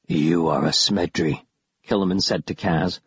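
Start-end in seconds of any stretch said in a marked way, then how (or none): 0.1-1.3 very low villain voice; deep, cold pronouncement; menacing creature. A narrator's reading. Killiman sizes up Kaz as a Smedri, low menacing creature.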